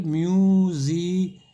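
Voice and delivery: slow, drawn-out syllable म्यूजी; syllable demo. Shown as a demonstration. Teacher spelling a word aloud for class, drawn out slowly. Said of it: "ये नुक्ता है".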